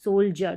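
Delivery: सोल्जर said again with the ज sound; correct soldier repeated. Shown as a demonstration. Teacher repeating the right word for students, clear ज. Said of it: "ना कि सोल्डर पढ़ते हैं".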